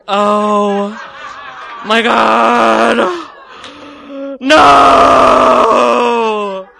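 long, loud drawn-out wail; mortified horror. Retold or imitated. Bystander learning the men are friends, long mortified mock howl. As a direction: stretch each word into a hammed-up howl. Horrified at her mistake.

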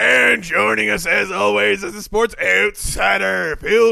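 loud gravelly announcer bark; weird announcer voice. Acted. Host introducing the guest in a big announcer voice. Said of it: "That is a terrible accent".

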